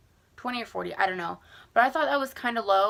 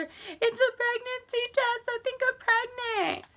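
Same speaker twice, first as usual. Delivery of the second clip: tearful. Retold or imitated. Tearful confession to mom.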